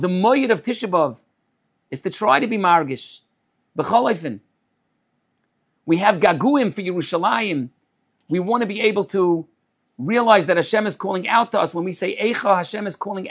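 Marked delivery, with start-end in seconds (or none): none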